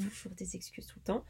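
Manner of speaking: unvoiced, very soft whisper. Real, not acted. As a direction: breathe it as an aside. Whispered aside.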